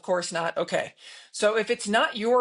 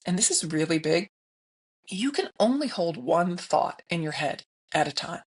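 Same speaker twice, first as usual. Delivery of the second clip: normal talking voice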